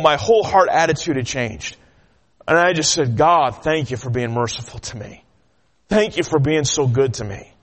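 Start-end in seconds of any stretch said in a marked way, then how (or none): 3.2-7.5 low, gentle gratitude; humble thankful prayer. Retold or imitated. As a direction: speak softly to God, sincerely.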